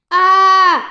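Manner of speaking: sharp high frightened cry; a sudden shriek of fright; startled scream. A narrator's reading. Character startled in the dark.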